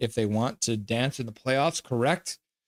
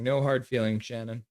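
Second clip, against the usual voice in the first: normal talking voice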